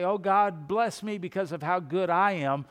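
mock the proud prayer, puffed up and loud. Preacher imitating the prayer he avoids, loud mock pride. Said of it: "So I don't come before God and say".

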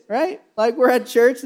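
ordinary delivery

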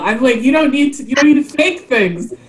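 high, animated gushing about sharks; excited. Real, not acted. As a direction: rave about how metal sharks are. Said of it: "sharks are so cool".

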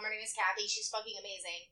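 ordinary delivery

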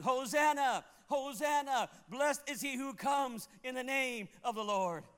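high, loud joyful crowd cry; crowd shouting hosanna. Retold or imitated. Preacher voicing the crowd at Jerusalem, loud high shout. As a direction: call it out like a crowd hailing a king. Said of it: "the people were shouting".

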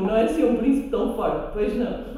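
ordinary delivery

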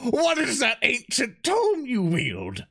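high, slow theatrical demand; mock-dramatic awe. Player theatrically asks Jason about his new book, mock awe. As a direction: drag out the question in grand mock wonder.

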